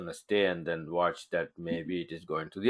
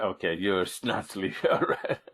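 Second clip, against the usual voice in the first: laughing. Real, not acted. Teasing sleepy student John.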